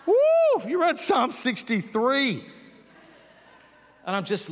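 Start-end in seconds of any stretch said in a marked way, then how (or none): 0.0-2.4 a high whooping cry then slow, loud awe; ecstatic woo. Retold or imitated. Answering her husband, overwhelmed with joy after reading the Psalm. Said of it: "excited reply".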